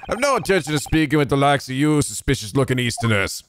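scornful Irish brogue; dismissive Irish governor. Acted. Governor sends the lawyer away, in a scornful Irish accent. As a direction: brush him off in an Irish accent.

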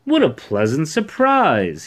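high, loud, drawn-out delight; happy cry. A narrator's reading. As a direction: cry out happily, slowly. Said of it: "he cried happily".

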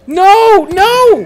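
very high, loud scream of horror. Real, not acted. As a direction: scream it in despair. Caught in game.

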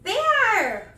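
loud, high, drawn-out call. Real, not acted. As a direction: holler a friend's name. Calling out to Bear.

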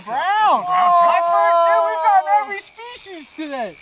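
loud, high excited shout; thrilled over a first catch. Real, not acted. First brown trout caught.

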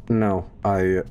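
ordinary talk

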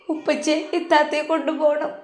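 put-on soft tearful child's plea; crying child plea. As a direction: plead through tears for daddy to bring her. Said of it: "കരയുകയായിരുന്നു".

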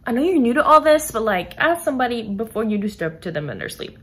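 exasperated scolding. Retold or imitated. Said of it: "and I'm like".

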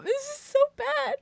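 high, disgusted whine. Real, not acted.

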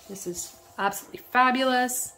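usual speaking voice

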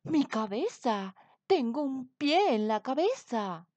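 king bellowing in horror; roaring king. A narrator's reading. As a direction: roar in horror at his head. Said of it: "bramó Anacreón".

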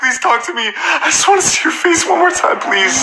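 high, pleading, breaking into a cry of please; desperate pleading. Acted. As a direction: beg her not to hang up, pitched high. Begging Samantha on phone.

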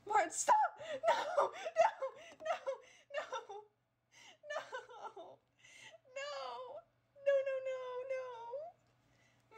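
high shrieking no over and over, laughing; screaming in laughing panic. Real, not acted.

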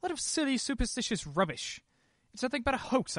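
husband loudly scoffs at his wife's ghost theory; angry outburst. Acted. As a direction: shout down a silly idea with contempt. Husband rejects wife's spooky suggestion.

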